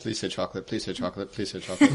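low, soft repeated aside; muttered wish. Pretend. Player hoping for the creature's answer, muttered low and soft. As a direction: wish under your breath, lightly.

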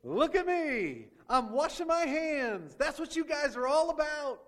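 mocking. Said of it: "He's saying, mocking the Sanhedrin".

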